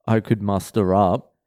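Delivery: ordinary talk, nothing marked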